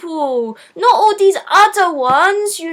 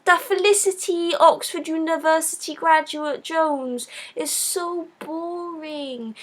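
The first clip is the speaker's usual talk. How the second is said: ordinary talk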